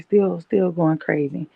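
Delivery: ordinary delivery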